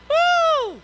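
high loud joyful whoop; excited whoop. Retold or imitated. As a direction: let out a celebrating woo. Recalling church-building joy.

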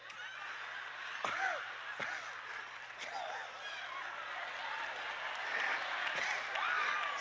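squealing laugh that rises; a long helpless laughing fit; high shrieking laugh. Real, not acted. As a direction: crack up completely. Laughing fit over hoe cake.

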